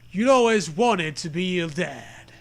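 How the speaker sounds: ordinary delivery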